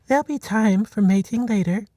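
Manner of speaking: light teasing herd mother; Henrietta's voice. A narrator's reading. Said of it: "Henrietta said".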